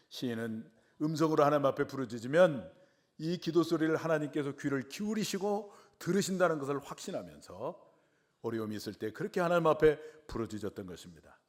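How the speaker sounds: ordinary talk, nothing marked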